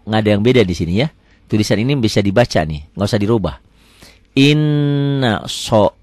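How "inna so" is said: "so" rounded, after a held n; slow it down and round the o of "so"; o vowel. Shown as a demonstration. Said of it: "ini pakai O nih".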